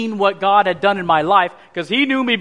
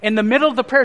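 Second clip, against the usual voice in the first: usual speaking voice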